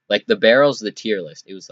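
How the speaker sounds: ordinary talk, nothing marked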